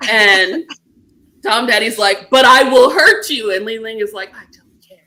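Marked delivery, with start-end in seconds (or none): 2.3-3.5 louder, mock-forceful warning; emphatic retold warning. Dom Daddy warning Li Ling in a retelling, mock forceful. 4.4-5.0 barely audible, imitated whisper; whispered surrender. Li Ling giving in to Dom Daddy, imitated whisper.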